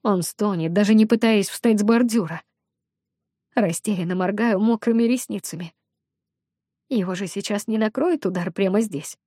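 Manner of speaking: ordinary delivery, nothing marked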